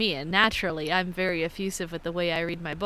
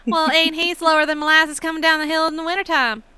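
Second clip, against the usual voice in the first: high, loud Southern country voice; Kentucky country accent; 7 semitones higher, 5 dB louder than usual. Acted. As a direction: put on a thick Kentucky accent for a folksy saying. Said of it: "I hate growing up in Kentucky".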